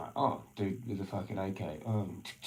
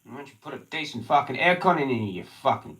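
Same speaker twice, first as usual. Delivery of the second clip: angry complaint; 5 semitones higher, 8 dB louder than usual. Real, not acted. Complaining about the aircon.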